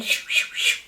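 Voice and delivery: whispered shoo shoo pour noise; mock pouring sound. Imitating the machine as she sneaks a shake.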